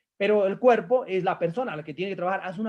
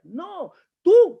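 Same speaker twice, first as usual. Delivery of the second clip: sudden loud, higher "No, tú"; emphatic burst; 9 semitones higher, 8 dB louder than usual. Real, not acted. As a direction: cut in sharply, much higher and louder. Coach cutting in emphatically.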